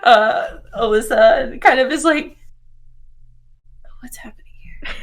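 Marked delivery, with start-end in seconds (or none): 4.0-4.9 barely audible, puzzled whisper; hushed, breath-only aside; bewildered whisper. Acted. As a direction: whisper it in confusion. Bewildered character's aside at awkward meeting.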